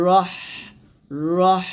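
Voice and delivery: "রহ" ending in a thin stream of air; "রহ" with an airy h; breathy final h. Shown as a demonstration. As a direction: let a thin breath out at the end of "রহ". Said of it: "এরকম বাতাস বের করবেন, চিকন বাতাস".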